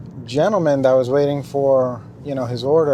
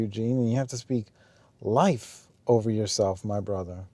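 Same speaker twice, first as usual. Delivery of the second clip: much softer, tender and low; gentle, hushed reassurance; 3 semitones lower, 6 dB quieter than usual. Retold or imitated. Gently reassuring a man with cancer, soft and tender. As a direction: speak softly, as if comforting a grieving friend. Gently encouraging the sick stranger.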